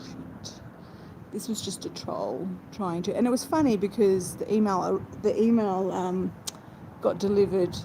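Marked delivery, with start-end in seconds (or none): 0.0-3.2 whispered aside, no voice; under-breath whisper. Real, not acted. Aside muttered under her breath.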